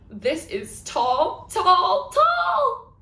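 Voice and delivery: rising tall. Shown as a demonstration. Librarian showing tall to children, climbing high. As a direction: stretch the voice upward on tall. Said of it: "And it goes like this".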